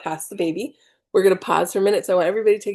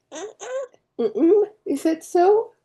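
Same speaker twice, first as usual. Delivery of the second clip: high, drawn-out doubting hum, then is that so; sceptical mock reply; 13 semitones higher, 4 dB louder, slower than usual. Mocking the meditation advice.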